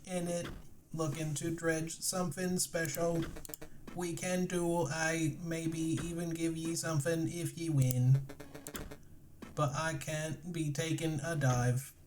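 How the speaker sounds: put-on a rough regional British accent, lightly done; a hint of a pirate-ish British accent; mixed British accent. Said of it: "these two accents sound right".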